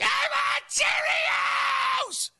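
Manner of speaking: very high imitation of a screaming child; mock toddler tantrum. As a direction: shriek it like a hammed-up preschool meltdown. Voices a child's store tantrum.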